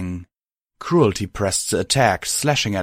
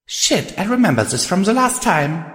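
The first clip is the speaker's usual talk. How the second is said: panicked thought; 10 semitones higher than usual. Acted.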